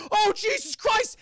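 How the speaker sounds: high, loud, drawn-out cry of alarm; panicked yelp. Acted. Charlie as sirens come up behind him, panicked. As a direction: yell it like a startled driver. Acting startled driver at sirens.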